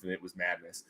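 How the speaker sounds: ordinary talk, nothing marked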